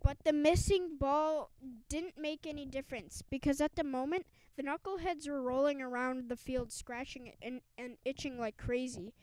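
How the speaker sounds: ordinary delivery, nothing marked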